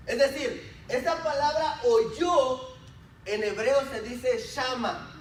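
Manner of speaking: preacher expounding a word; emphatic preaching. Real, not acted. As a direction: stretch out the key words for the congregation. Preaches, explaining a Hebrew word.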